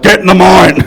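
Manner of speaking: mildly yelled command; mock shouted order. Boss ordering new arrivals to work, mock shout. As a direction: bark an order, half laughing.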